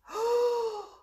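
loud, high sucked-in breath; demonstrated huff. Shown as a demonstration. Teacher showing children how to huff, big loud breath. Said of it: "Can you huff".

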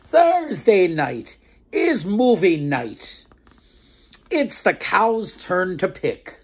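slow, clear storybook narration; reading to children. A narrator's reading. Storyteller narrating the farm tale to children. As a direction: read it out slowly for little ones.

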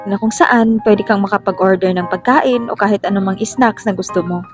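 ordinary delivery

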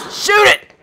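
high, loud frantic yell; frantic shout. Real, not acted. Player yelling at Jill to shoot, frantic and loud. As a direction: yell at her to fire. Urging character to fire.

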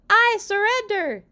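high, loud, drawn-out yell. A narrator's reading. Father giving up the pillow fight, yelling. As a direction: yell it out in mock defeat. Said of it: "he yelled".

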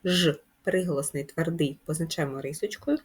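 ordinary talk, nothing marked

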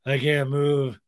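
mock depressed old man. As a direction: say it with understated comic gloom. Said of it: "he gets really depressed".

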